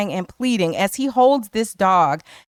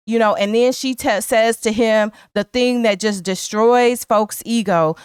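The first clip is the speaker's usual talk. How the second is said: ordinary talk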